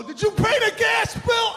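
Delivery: high, loud shrill-free mock nag; nagging wife. Imagined wife asking mid-ride, loud mock nag. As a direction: blurt it out like a pestering spouse.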